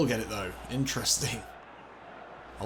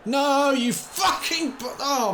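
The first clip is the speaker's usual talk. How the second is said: very high, slow, loud angry scream; furious scream; 6 dB louder, much slower than usual. Real, not acted. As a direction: scream it at the screen. Screaming at the game.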